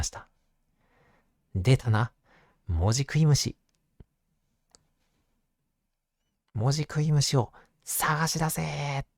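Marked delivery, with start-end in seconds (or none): 1.5-9.1 lightly voiced eager teacher; teacher's call. A narrator's reading. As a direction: call out like a bug-hunting teacher. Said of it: "めくって言いました".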